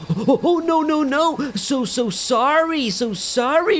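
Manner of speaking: high, panicked apology; scared apology. Retold or imitated. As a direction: beg forgiveness in mock fright. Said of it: "I'm scared".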